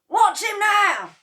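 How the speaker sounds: high, loud, slow excited call; excited cry. A narrator's reading. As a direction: urge everyone to look. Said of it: "cried Mister Cranky".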